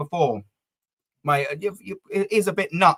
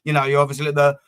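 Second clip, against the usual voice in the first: normal talking voice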